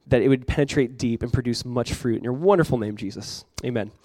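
hushed earnest prayer, dropping away at the end. Acted. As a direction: pray it quietly over the congregation. Pastor leads closing prayer.

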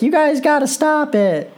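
high, pleading mock whine; mocking Natalya scolding from commentary in a high whine; whiny coach voice. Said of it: "she started blowing her whistle and be like".

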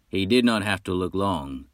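plain reading voice

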